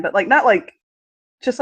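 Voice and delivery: ordinary talk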